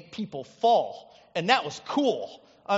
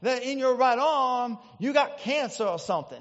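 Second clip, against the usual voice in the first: put-on Ernest Angley's accent; 3 semitones higher than usual. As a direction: mimic a Southern TV preacher making a diagnosis. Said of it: "And Ernest Angley said".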